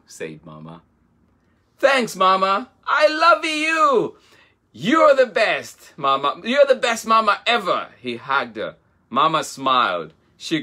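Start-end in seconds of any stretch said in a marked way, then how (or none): 1.8-7.9 put-on high, loud, overjoyed child; high happy shout of a little boy; joyful thanks. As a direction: gush your love to mama. Child thanks mother, hugs her.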